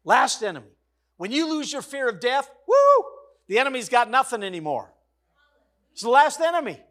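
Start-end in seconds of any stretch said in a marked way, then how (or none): 2.7-3.0 a high, loud exultant whoop; excited woo. Real, not acted. Preacher whooping at the church over death beaten, excited. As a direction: let out a joyful shout.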